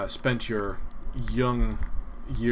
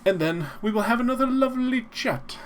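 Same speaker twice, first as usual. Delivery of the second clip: high sing-song; 12 semitones higher, 4 dB louder than usual. Acted. Sing-song sign-off line.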